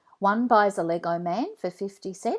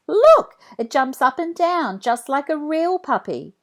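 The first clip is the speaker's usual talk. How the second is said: excited; 8 semitones higher, 8 dB louder than usual. Acted. Girl showing off the toy.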